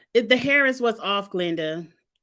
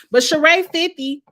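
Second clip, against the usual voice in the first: louder and higher, bursting out; excited exclaim; 7 semitones higher, 7 dB louder than usual. Real, not acted. Reviewer pointing out Sheree is fifty, excited. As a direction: hit it like a gotcha.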